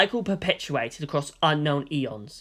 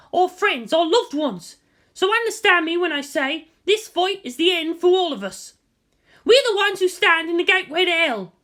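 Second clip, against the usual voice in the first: high, loud war speech to the troops; much higher, loud commander's address; rallying battle speech; 14 semitones higher, 4 dB louder than usual. Acted. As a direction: stir the soldiers before the last fight. Mana's long rallying speech.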